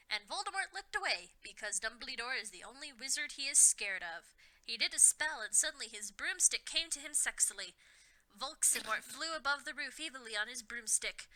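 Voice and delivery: ordinary delivery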